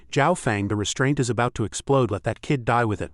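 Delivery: usual speaking voice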